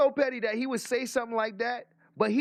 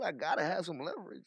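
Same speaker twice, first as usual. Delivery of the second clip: laughing mock quote; 5 semitones lower, 4 dB quieter, faster than usual.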